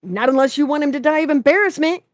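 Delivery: loud, high, rapid retort; blazing anger. A narrator's reading. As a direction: fire it back hotly. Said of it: "Jason blazed".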